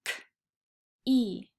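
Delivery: K-E stretched sound by sound. Shown as a demonstration. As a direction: stretch key out for children. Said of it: "Let's stretch the word key".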